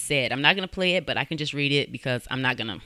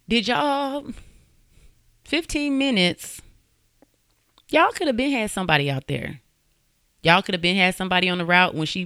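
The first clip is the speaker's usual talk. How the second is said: pitched-up, incredulous reproach; indignant disbelief; 5 semitones higher, 3 dB louder than usual. Real, not acted. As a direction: call them out in rising indignation. Host indignant at slow police response.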